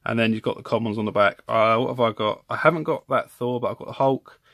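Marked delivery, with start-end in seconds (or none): none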